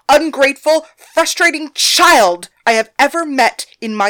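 put-on loud, fuming tirade about the stubborn girl; scorned lover rants to the other girls, furious and loud. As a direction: vent every insult at full volume. Thalia storms in ranting.

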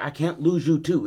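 a father pleading with his son. Acted. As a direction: plead it, voice lifted.

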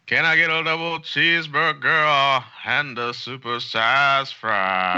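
high, slow singer voice ordering food; Hootie voice. Retold or imitated. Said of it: "in the [name] voice".

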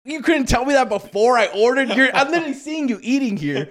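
can't believe what you just heard; squeaky outraged laugh-talk; incredulous disbelief. Retold or imitated. Confronting waiter after ordering.

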